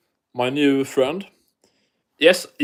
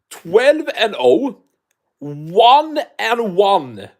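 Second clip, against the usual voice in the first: incredulous; 4 semitones higher, much slower than usual. Real, not acted. Disbelief reading opponent records.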